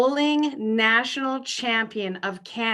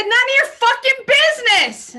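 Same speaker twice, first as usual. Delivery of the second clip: loud, much higher yell, mock offended; mock indignant yell; 14 semitones higher, 7 dB louder than usual. Answering the neighbor's pipes question, mock offended loud yell. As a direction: shout it back like the question was rude. She snaps back at neighbor.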